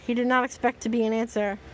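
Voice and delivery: ordinary delivery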